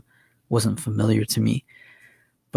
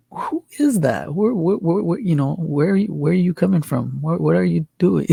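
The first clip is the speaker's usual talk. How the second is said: higher-pitched, stumbling retold questions; puzzled calling out; 6 semitones higher than usual. Dreamer questioning an unseen voice, puzzled and higher-pitched. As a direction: act out bewildered questions to an unknown voice, higher.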